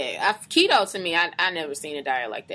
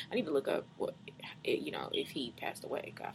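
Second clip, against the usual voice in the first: murmured aside; 5 semitones lower, 15 dB quieter than usual. Real, not acted. Wondering about the boy's fate mid-reading, murmured aside. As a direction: trail into a whisper, as if to yourself. Aside to self while checking a fact.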